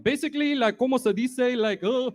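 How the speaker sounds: high, mocking imitation of Ryan's teasing. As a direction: ham up his goofy Spanglish teasing. Mocking Ryan teasing ex-fiance.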